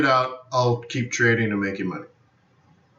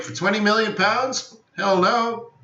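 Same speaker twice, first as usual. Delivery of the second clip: incredulous refusal; 7 semitones higher than usual. Retold or imitated. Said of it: "I'm like".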